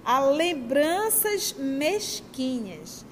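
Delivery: "lembranças mesquinhas" drawn out very slowly, word by word; stressed phrase. Shown as a demonstration. Speaker pointing the audience to the key words, drawn out slowly. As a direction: linger on "lembranças" and "mesquinhas", slow and falling. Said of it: "Olha a palavra".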